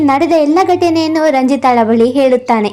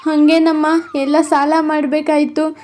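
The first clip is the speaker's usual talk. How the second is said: softer, defeated mother; sad confession; 3 dB quieter than usual. Acted. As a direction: quietly admit everything is lost. Poor mother confessing debts. (ಮಗಳಿಗೆ ಸಾಲದ ಕಥೆ ಹೇಳುವ ತಾಯಿಯ ದುಃಖದ ಮೆಲುದನಿ)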